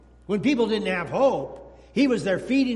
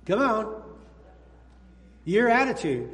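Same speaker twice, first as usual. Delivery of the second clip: ordinary delivery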